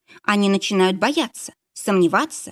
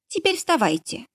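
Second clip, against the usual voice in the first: Lisa's voice; 9 semitones higher than usual. A narrator's reading.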